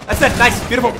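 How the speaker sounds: excited cheer. Real, not acted. Celebrating a kill.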